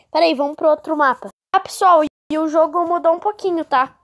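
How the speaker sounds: ordinary delivery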